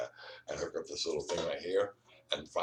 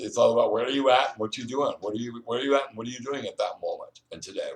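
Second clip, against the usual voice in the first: usual speaking voice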